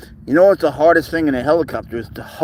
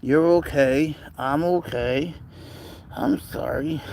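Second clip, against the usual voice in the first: soothing a scared pet; 3 semitones higher, slower than usual. Real, not acted. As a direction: whisper comfort to a frightened cat. Comforting cat, softening.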